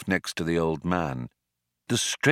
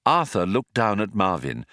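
ordinary delivery, nothing marked

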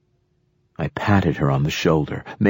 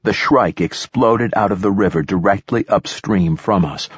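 usual speaking voice

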